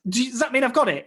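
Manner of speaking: excited disbelief at hearing he got the part. Retold or imitated. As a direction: ask it like you can't believe your luck. Disbelief at being offered the job.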